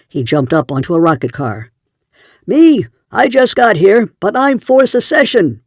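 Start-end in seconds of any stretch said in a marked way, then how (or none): 2.5-5.6 shouting. A narrator's reading. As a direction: proclaim it from atop the car. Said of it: "he shouted".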